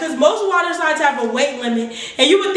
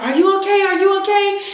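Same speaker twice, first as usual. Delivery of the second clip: higher, concerned staff checking on her; worried attendants; 5 semitones higher than usual. Retold or imitated. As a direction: call out like a worried lifeguard.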